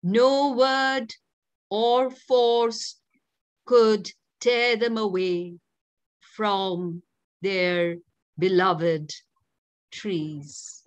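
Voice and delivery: measured poetic reading; poem recitation. Acted.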